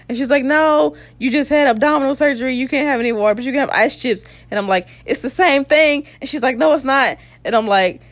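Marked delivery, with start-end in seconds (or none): 0.4-4.3 high-pitched imitation of a scolding nurse; lightly hammed-up nurse, pitched well up; mock nurse refusal. Nurse refusing water after surgery, mock scolding. 5.1-6.1 high, indignant mock objection; retold protest. Patient arguing with nurse over ice chips, mock protest. 6.6-7.2 high-pitched imitation of contradicting; quick raised comeback as the nurse; mock nurse contradiction. Nurse arguing back about ice chips, mock contradiction.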